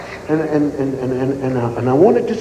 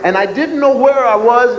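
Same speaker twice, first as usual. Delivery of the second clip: ordinary talk, nothing marked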